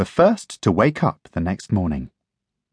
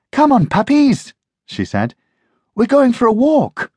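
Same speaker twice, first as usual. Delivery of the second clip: put-on cheerful girl voice; 4 dB louder than usual. Jackie calls puppies.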